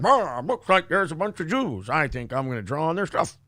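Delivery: a hint of a thug impression; exaggerated cartoon intonation.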